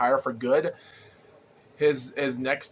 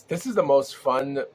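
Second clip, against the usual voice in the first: usual speaking voice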